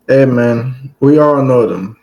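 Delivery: ordinary delivery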